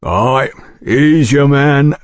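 very slow, considered proprietor's voice; recognising the dead man's picture; gruff proprietor. A narrator's reading. Sayers identifying portrait.

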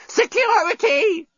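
very high, drawn-out robot shriek; screaming robot. A narrator's reading. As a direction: shriek for help as a panicked robot. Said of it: "screamed the deskbot".

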